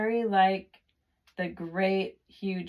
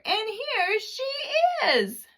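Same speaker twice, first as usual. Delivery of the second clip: much higher, slow crying cub; Baby Bear's tiny voice; 3 dB louder, slower than usual. A narrator's reading. Narrator voices Baby Bear crying about his bed, high and slow. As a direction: wail it like a tiny upset bear. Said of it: "cried Baby Bear".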